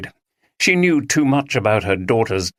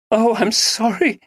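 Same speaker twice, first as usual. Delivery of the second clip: sobbing; 13 semitones higher than usual. Acted. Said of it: "she sobbed".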